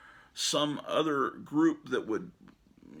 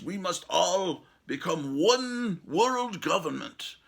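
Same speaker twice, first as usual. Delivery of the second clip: slow, loud, higher villain imitation in a German accent; 6 semitones higher, 5 dB louder, slower than usual. Quoting Klaus Schwab's speech, German accent. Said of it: "Klaus Schwab says".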